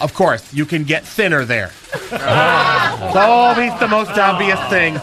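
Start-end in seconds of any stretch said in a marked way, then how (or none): 1.9-3.1 breathy laugh. Real, not acted.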